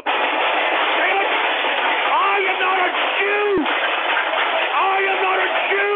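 high, loud exasperated yelling; furious shout. Real, not acted. Host yelling back at troll viewers, furious shout. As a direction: blow up at the trolls.

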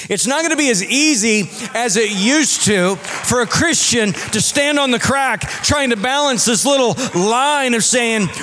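high, loud, relentless pulpit crescendo; full-force preaching. Real, not acted. Preacher warning compromising Christians, full-force and urgent. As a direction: build without letup, hammering each phrase.

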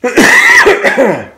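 a loud, real cough from a cold; sudden loud coughing fit. Real, not acted. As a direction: cough hard, sick with a cold. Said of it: "I got a cold".

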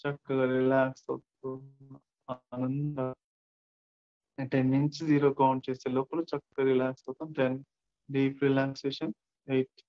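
slow, drawn-out countdown with long silences; guided meditation. Acted. Count listeners down into deep relaxation, guided meditation.

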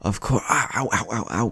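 understated cries of pain; yelling in pain. A narrator's reading. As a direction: ear being tugged, ow ow ow. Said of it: "yelled in pain".

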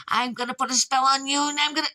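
high, cackly curse threat; witch threat. Acted. Old lady threatening the dad with a spell, high. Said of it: "the little old lady said".

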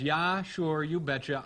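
normal talking voice

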